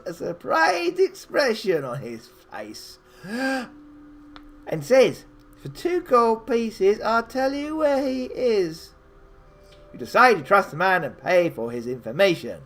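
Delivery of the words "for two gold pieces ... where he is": a lightly voiced sly old beggar, a bit higher; a hint of a cunning old man naming his price, slow on the first words; lightly voiced beggar bargaining. Beggar answers the player.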